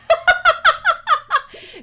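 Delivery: high loud cackling laugh; cackle. Real, not acted. Streamer cackling after apologizing to chat.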